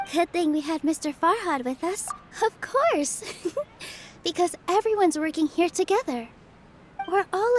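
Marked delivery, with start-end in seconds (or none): 3.3-4.0 a quick airy laugh; soft breathy chuckle. Acted. Host laughs softly at the freebie joke, a breathy chuckle. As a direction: laugh lightly, almost silent.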